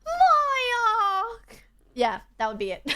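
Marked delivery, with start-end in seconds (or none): 0.1-1.4 very high, loud crying squeal; extra Emily voice. Pretend. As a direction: wail the name in a high anime-girl voice. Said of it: "But her one is like".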